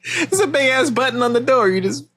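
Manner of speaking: high, laughing protest; laughing disbelief. Real, not acted. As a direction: laugh through the words. Laughing disbelief about car door.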